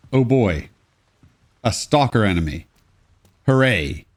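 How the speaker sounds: ordinary talk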